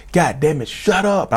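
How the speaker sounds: high-pitched reenacted snap; frustrated yell without full volume; retold yelling. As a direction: act out losing patience, pitch jumping up. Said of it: "I was yelling and screaming at him".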